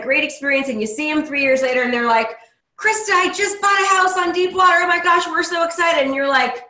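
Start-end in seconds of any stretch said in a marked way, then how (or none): 2.8-6.1 high-pitched, excited outpouring of news; gushing past client. Retold or imitated. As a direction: gush it in a higher voice, all thrilled.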